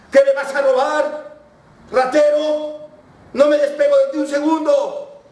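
high, loud accusing yell; shouting. Retold or imitated. Taxi driver accuses his passenger of stealing, shouting. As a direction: yell like you are being robbed. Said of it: "le empecé a gritar".

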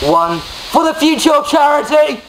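high, loud rallying cry; excited shout. Real, not acted. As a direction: shout it as the countdown ends. Countdown before pouring ice.

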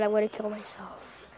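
low, soft, defeated grumble; exasperated mutter. Real, not acted. Player annoyed by opponent, exasperated mutter.

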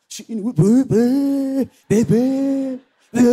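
loud burst of nonsense chatter; mock gossip babble. Friend spreading news to the group, mock babbling.

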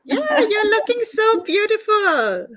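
high, gushing praise; excited compliment. Real, not acted. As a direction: gush over how she looks. Host compliments guest's outfit.

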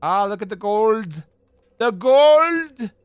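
hammed-up cry over treasure, swelling on GOLD; excited shout. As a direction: exclaim it, building to a loud, high, drawn-out cry. Mock cry on seeing gold.

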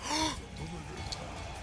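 sudden scared gasp; sharp frightened gasp. Real, not acted. Monster appears, being chased.

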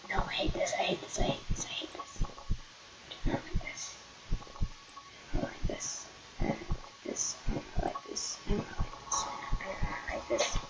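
whispered, panicked repeating; scared whisper. Real, not acted. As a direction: whisper it over and over in dread.

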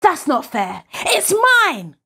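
high-pitched protest building to a wail; outraged child, voice shooting up; indignant cry. Acted. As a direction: cry out at the unfairness. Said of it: "cries Ferdinand".

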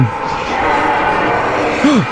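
long unvoiced gasp; frightened gasp. Real, not acted. Streamer dreading the next creature, long gasp. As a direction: hold breath in dread.

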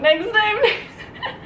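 high laughing "Next time". Real, not acted. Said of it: "laughing echo".

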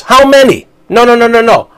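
mocking disbelief, voice jumping up; scoffing at the idea; incredulous. Real, not acted. Mock incredulous outburst at rivals.

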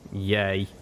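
ordinary delivery

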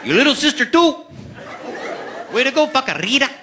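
mock Mexican dad calling out praise; proud dad. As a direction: cheer the kids on like a beaming dad. Dad praising kids, voiced by comic.

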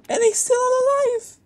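very high, loud disbelieving squeal; incredulous squeal. Real, not acted. Gamer squealing because the enemy survived a full magazine. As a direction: squeal it like you can't believe he's alive.

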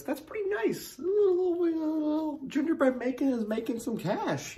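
very high squeal, half laughing; squeaky laughing voice. Acted. Host admiring the can's gingerbread house, high squeaky laugh. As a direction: crack into a high giggly falsetto.

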